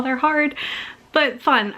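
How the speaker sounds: ordinary delivery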